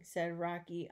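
ordinary delivery, nothing marked

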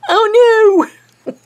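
high, loud alarmed exclamation; alarmed outcry. Real, not acted. Crafter realizing she'll run out of thread, high alarmed outcry. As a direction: yelp it as the thread runs short.

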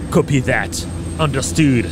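English words in a French accent; radio sign-off in French-accented English. Real, not acted. Translating the game's radio message live, French-accented English. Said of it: "avec mon super accent anglais".

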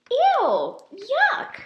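high, loud sneering "Ew! Yuck!"; sarcastic disgusted crowd. Acted. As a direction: jeer at him, high and loud. Mole rats mock Wilbur.

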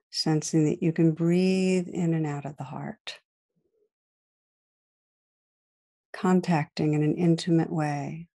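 calm guided meditation voice with long pauses. Real, not acted. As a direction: guide listeners gently inward, leaving space to breathe.

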